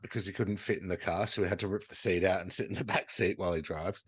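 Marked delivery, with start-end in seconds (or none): none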